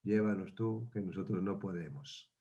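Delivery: Jesus dozing in the boat, murmuring drowsily; sleepy Jesus. Acted. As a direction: mumble it half asleep. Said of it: "más dormido estaba diciendo".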